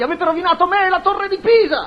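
high outburst of despair; furious accusation. Acted. Engineer ruined by portiera and cat, high furious accusation. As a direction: cry out your ruin in high indignation.

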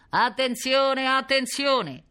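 high, loud, drawn-out call; booming loudspeaker announcer. A narrator's reading. Police loudspeaker calls to the whole neighbourhood, booming announcement. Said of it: "tuonò la voce di un altoparlante".

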